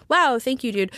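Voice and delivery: sarcastic thanks; retold sarcasm. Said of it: "responded to him kind of sarcastically".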